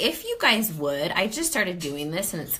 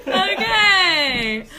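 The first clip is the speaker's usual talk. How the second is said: laughing okay; 13 semitones higher, 11 dB louder than usual. Real, not acted. Laughing at the mix-up.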